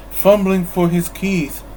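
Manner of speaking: ordinary delivery, nothing marked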